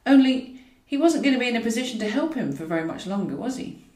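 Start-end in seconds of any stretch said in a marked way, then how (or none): none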